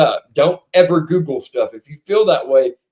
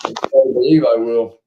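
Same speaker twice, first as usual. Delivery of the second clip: usual speaking voice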